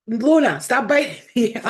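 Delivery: loud, high scolding of the dog; yelling at dog. Real, not acted. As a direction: call out sharply at the biting dog. Dog biting her.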